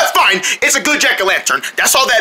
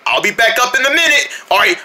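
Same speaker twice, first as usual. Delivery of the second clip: usual speaking voice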